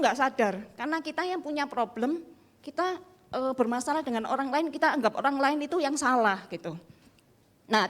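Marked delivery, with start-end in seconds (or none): none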